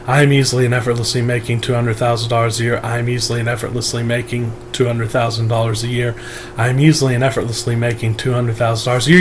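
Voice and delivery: quick, low, flat monotone repetition; robot voice. Shown as a demonstration. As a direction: rattle it off like a machine. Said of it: "Just keep affirming robotically".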